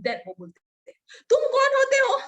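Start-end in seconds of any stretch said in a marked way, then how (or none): 1.3-2.2 much higher and louder, a strained outburst; imitated furious yell. Uncle shouting at the neighbour girl, furious yell. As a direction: scream it as the enraged uncle.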